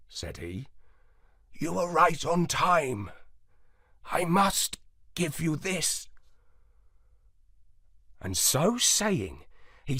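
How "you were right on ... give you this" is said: a hint of a calm ancient dog, softer; softly, as the old moondog; lightly voiced old dog. A narrator's reading. Moondog speaks.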